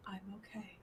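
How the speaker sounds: hushed, tender murmur; soft reassurance. Acted. Giving his hand a small squeeze, softly reassuring. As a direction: keep it small and quiet.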